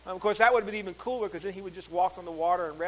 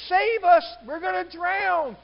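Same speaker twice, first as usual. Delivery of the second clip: high, loud yell drawn out at the end; 11 semitones higher, 11 dB louder, slower than usual. Acted. Disciple waking Jesus in a storm, yelling. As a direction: yell for rescue over a howling wind. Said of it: "he's just yelling".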